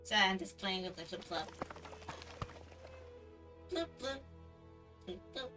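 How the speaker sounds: ordinary delivery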